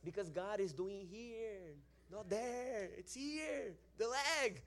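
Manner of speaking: high, stretched-out pleading correction; exasperated insistence. Retold or imitated. Praying man redirecting attention to the leg, exasperated insistence. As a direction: insist on the right spot, voice climbing.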